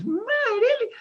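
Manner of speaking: very high mock-amazed squeal; hammed-up Greek owner's astonishment, shooting up in pitch; Greek restaurant owner. Restaurant owner hearing the customer speak Greek, high mock amazement. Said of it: "And he goes".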